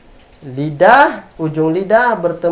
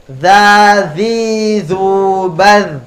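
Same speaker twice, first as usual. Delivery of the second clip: punchier, louder "Za, zi, zu, baz"; more energetic drill; 4 semitones higher, 5 dB louder, much slower than usual. Shown as a demonstration. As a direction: give it more spirit this time. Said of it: "Lagi yang lebih semangat". (ucapkan lebih bersemangat)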